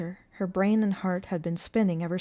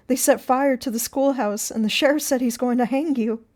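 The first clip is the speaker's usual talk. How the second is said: high, loud, anxious explanation; worried, raised voice; urgent alarm; 6 semitones higher, 7 dB louder than usual. A narrator's reading. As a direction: blurt out the bad news. Warning lover of the hanging.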